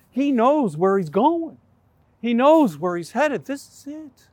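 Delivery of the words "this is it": softer, higher hushed "This is it". Shown as a demonstration. Preacher stressing the point of no return, hushed. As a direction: drop to a near hush.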